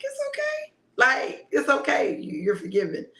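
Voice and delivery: mother forgiving her daughter, moved; tearful reassurance. Retold or imitated. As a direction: soothe her through tears. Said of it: "I'm like".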